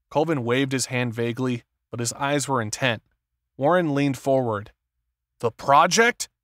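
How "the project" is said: startled question, higher and louder; gasped. A narrator's reading. Warren leaning forward at news of the project, gasping. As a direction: blurt it as a shocked, rising question. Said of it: "he gasped".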